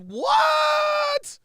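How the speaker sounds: a high, loud, disbelieving what?; shocked shout. Real, not acted. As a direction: yell it in total disbelief. Reacting to John's clip.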